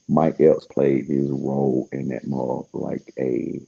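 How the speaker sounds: normal talking voice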